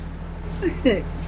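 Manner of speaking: soft laughter; amused laugh. Acted. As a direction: laugh quietly at their expense. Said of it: "why are you laughing".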